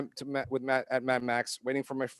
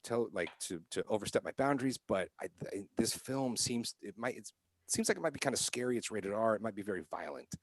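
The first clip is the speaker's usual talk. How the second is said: soft, polite and careful; very politely; 2 semitones lower, 8 dB quieter than usual. Retold or imitated. Man politely warning a mother beside him, soft polite voice. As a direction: tiptoe around it gently. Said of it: "very, very politely".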